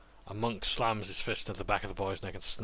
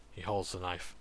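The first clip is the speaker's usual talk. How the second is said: ordinary talk, nothing marked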